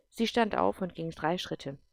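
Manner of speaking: ordinary talk, nothing marked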